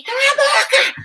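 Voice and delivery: high shout breaking into a laugh; retold shout. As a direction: yell it half laughing. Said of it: "E aí eu falei". (grito agudo com riso)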